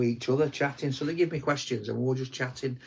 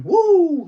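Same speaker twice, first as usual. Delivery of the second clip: high, loud "woo"; excited whoop; 10 dB louder than usual. Pretend. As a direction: let out a big, high whoop. Gleeful farm cheer.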